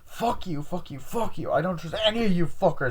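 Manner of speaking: high-pitched acted fury; angry outburst. Cornered girl cursing the group, angry high outburst. Killer reveal quarrel.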